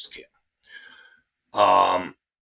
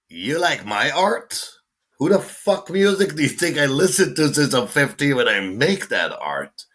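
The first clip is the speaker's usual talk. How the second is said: indignant disbelief; 8 semitones higher, 4 dB louder than usual. Retold or imitated. Telling a famous drummer what music he listens to, higher incredulous rant. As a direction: let the disbelief build into a rant. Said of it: "and I'm like".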